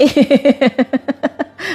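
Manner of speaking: a brief amused laugh from the belly; short real laugh. Real, not acted. Preacher laughing at a child's comment. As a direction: let a real laugh slip out.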